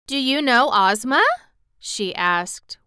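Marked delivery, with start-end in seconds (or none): 0.1-1.2 higher, louder wondering question. A narrator's reading. Dorothy asks the king about Ozma, wondering. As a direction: ask with raised pitch, mildly wondering.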